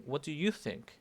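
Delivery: YOU as the peak of the question; stress on YOU. Shown as a demonstration. Teacher asking one specific person's view, stress on YOU. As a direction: punch the word you. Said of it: "se acentúa el you".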